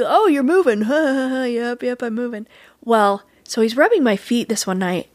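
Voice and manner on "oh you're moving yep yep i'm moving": playful mock announcement; teasing joker. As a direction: imitate a jokester pretending to be amazed, with a laugh. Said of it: "he's a jokester, he's a tease".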